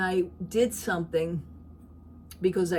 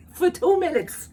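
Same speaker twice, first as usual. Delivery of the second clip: laughing; 11 semitones higher, 7 dB louder than usual. Real, not acted. Laughing at the memory.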